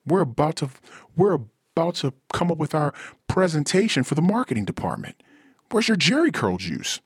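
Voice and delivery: high, incredulous mockery; high-pitched ridiculing coworker; mocking coworker. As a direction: scoff at his missing disco gear. Improv character mocking coworker.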